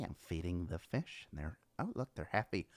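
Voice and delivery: very soft, low fish-feeding narration; imitating Mister Rogers. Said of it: "how Mister Rogers would narrate his fish feedings".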